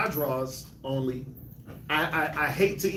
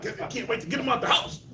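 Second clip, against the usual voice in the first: Bernie Mac; 7 semitones higher than usual. Retold or imitated. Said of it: "the Bernie Mac".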